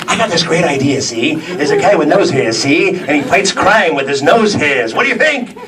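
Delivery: high, eager pitch with a laugh; fast-talking pitchman. Retold or imitated. Mocking the studio pitch meeting, a high eager pitchman. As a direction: sell the silly idea like a hustler. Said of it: "they pitch the idea and say".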